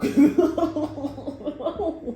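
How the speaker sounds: a long high laugh trailing down; high laugh falling away; amused laugh. Real, not acted. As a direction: laugh at the absurdity. Laughs after No.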